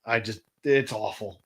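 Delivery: normal talking voice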